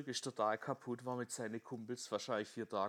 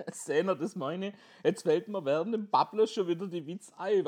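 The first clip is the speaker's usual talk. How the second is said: usual speaking voice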